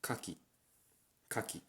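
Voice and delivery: kaki said softly in Japanese; Japanese word. Shown as a demonstration. Teaching the Japanese word kaki. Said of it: "the Japanese word for it is".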